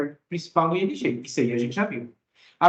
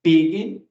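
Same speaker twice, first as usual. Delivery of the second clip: "Begin" with a loud first syllable "Be"; stress on first syllable; 5 dB louder than usual. Shown as a demonstration. English teacher demonstrating the mistake to students, first-syllable stress on "Begin". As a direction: punch the "Be" of "Begin", weak "gin". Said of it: "a entonação está no bi".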